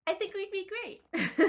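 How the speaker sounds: high, drawn-out talking-doll voice; konuşan oyuncak bebek. Pretend. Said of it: "if there was a doll of you that talked".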